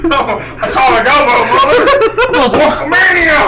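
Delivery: loud, laughing mock wrestler growl; Hulk Hogan voice. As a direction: hype it up like a wrestling promo. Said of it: "more like Hulk Hogan".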